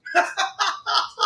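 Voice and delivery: a delighted shriek of a laugh; real laughing outburst. Real, not acted. Laughs at Joker killing designer.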